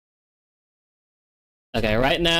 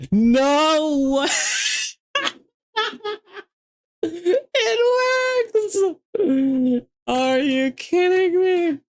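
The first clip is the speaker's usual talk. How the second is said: very high squealing with laughter; half laughing, half crying with joy; overjoyed shriek; much slower than usual. Real, not acted. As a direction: ecstatic high-pitched squeal. Program works, shrieking with joy.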